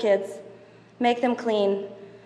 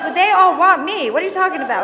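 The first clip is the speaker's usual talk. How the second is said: playful protest; 7 semitones higher, 6 dB louder than usual. Retold or imitated. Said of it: "I'm like".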